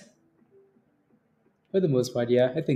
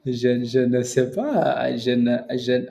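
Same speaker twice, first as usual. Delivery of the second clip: mock French; 2 semitones lower, slower than usual. Joking French answer.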